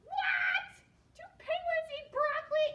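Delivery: very high, loud squealed question; mock disbelief. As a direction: act utterly astonished for the children. Mock disbelief at the silly dinner.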